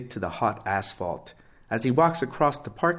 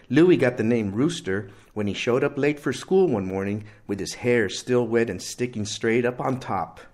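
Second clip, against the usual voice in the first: ordinary delivery, nothing marked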